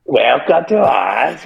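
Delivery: slow, sing-song welcome to Oz; quoting Oz. Retold or imitated. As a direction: drawl it like the ring intro. Echoing the Oz line.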